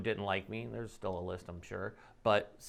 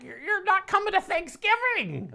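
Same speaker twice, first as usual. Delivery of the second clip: shrill mock outrage, pitched way up; hammed-up indignant family member; angry relative; 8 dB louder than usual. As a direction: mimic a furious relative banning you from dinner. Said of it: "some of these people that are like".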